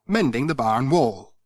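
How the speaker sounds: plain reading voice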